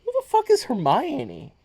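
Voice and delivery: high-pitched, indignant confusion; retold baffled outburst; mock bafflement. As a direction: ask it in high, baffled disbelief. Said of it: "And it's like".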